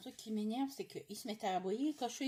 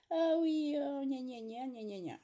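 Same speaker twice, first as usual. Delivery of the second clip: mock whining; 4 semitones higher, 4 dB louder, slower than usual. Mocking the children's constant whining.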